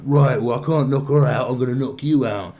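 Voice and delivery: loud lad-in-a-pub threat; mock bloke threatening. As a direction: square up and shout the threat. Acted pub quarrel, man threatening.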